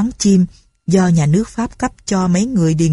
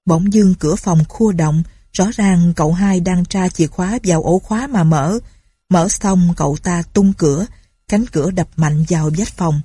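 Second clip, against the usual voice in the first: usual speaking voice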